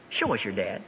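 low, soft acted disciple; hesitant request. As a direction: ask quietly, after a hesitation. Voices Philip's hesitant request.

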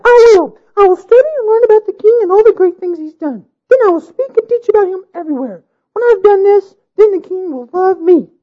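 high, fierce vowing bride; determined bride. A narrator's reading.

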